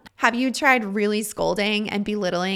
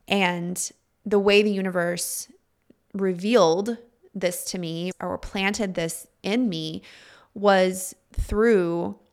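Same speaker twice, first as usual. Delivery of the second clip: normal talking voice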